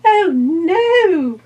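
very high, loud frightened fly cry; housefly. Acted. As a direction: squeal it out in alarm. Fly cries out in fear.

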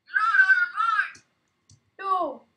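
loud high protesting yell; panicked shout. Real, not acted. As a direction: shout to stop them taking it. Claiming loot in panic.

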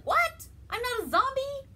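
very high, loud disbelief; shocked. Retold or imitated. Jefferson learns he is no zombie, shocked squeal. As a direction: squeal the surprise. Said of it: "he's like".

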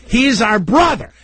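high-pitched mock child announcing news; imitated kid's gleeful reveal; excited kid. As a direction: blurt it out like a thrilled little boy. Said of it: "so, so they're excited that".

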